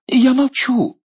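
blurted out in a high voice; quickly. Acted. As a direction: say it fast and scared. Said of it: "Министр иностранных дел быстро". (быстро и испуганно)

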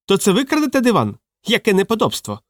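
high-pitched indignant fat man; outraged fat man. A narrator's reading. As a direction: scold in a high, scandalised voice. Fat man's outraged scolding.

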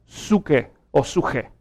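put-on suke then suje, old Erasmian sounds; ancient Greek suke. Shown as a demonstration. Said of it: "la pronunciación antigua se hubiera leído".

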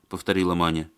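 usual speaking voice

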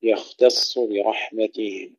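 يختص with thin ya and ta, thick kha and sad, slowly; heavy kha and sad. Shown as a demonstration. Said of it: "ترقيق الياء وتفخيم الخاء". (ترقيق الياء والتاء وتفخيم الخاء والصاد في يختص)

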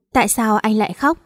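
plain reading voice

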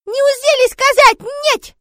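put-on high, loud, slow little-girl shriek; child yelling. Sonya kicking her father, childish high yell. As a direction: yell it as an outraged small child. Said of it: "а затем заорала".

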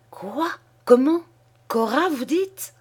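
high-pitched startled questions; taken aback. A narrator's reading. Husband hearing his wife is pregnant, dumbfounded. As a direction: stumble out disbelieving questions. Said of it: "L'époux interdit perdait contenance".

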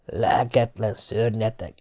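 soft, slow self-scolding; self-reproach. A narrator's reading. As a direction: scold yourself slowly under your voice. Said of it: "mondtam önmagamnak".

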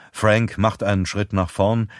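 ordinary delivery, nothing marked

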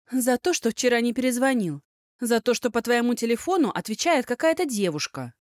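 high, loud indignant scolding; angry scolding. Acted. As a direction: scold him, hurt and furious. Heroine angrily scolds boyfriend.

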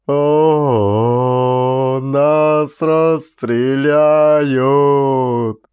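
very slow, loud drawn-out wail; despairing wail. A narrator's reading. Doomed soldier wails in despair. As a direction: moan it as if already doomed.

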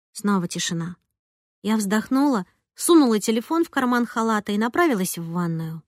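plain reading voice

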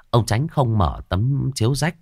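usual speaking voice

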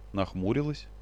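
usual speaking voice

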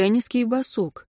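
ordinary delivery, nothing marked

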